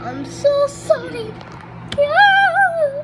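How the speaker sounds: high, loud squealed apology. Real, not acted.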